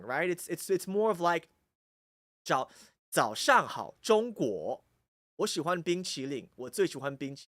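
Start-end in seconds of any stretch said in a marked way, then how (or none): 2.0-7.5 even, unexaggerated Taiwanese Mandarin without country twang; Taiwanese Mandarin accent. Shown as a demonstration. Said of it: "if you're in Taiwan, it's not that".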